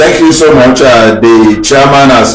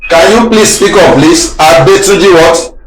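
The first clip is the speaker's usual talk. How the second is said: higher, insistent request on a poor line; straining to be heard; 7 semitones higher than usual. Real, not acted. Host asks a caller to speak up, insistent. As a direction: press a faint caller to repeat.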